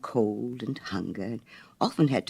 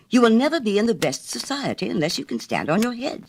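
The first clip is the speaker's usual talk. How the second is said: a high, lightly voiced fussy mother duck; 9 semitones higher, faster than usual. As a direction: nag the ducklings in a raised, prim voice. Mother duck teaching her ducklings.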